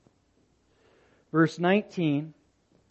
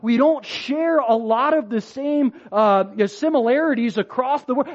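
doubtful inner voice; 6 semitones higher, 5 dB louder than usual. Retold or imitated. Voices his own doubting thoughts.

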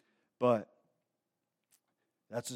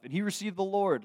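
rising high on the Lord, thrilled; bright, lifted joyful line; joyful emphasis; 8 semitones higher than usual. Real, not acted. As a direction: announce it like good news. Joyful report of conversion.

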